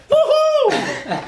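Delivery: very high, laughing whoop; hyper cheer. Real, not acted. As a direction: squeal with joy. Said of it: "That's why you're so hyper".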